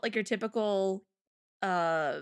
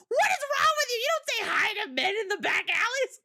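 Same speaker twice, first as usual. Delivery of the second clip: high, loud scolding friend; mock scolding; 13 semitones higher, 4 dB louder than usual. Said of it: "she's like".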